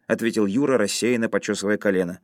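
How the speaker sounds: usual speaking voice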